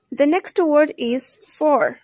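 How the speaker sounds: usual speaking voice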